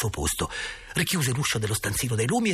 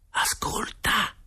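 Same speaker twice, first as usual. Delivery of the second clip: slow, unvoiced whisper; much slower than usual. A narrator's reading. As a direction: breathe it out as a secret to a friend beside you. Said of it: "dissi in un soffio di voce". (sussurro in un soffio di voce)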